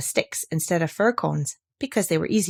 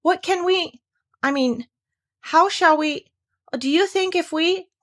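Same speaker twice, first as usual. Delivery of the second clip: in distress; 9 semitones higher than usual. A narrator's reading. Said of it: "said Pooh in distress".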